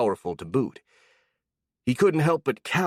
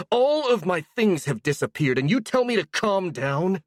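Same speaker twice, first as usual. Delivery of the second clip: angry; 8 semitones higher than usual. Acted. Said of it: "screaming those words".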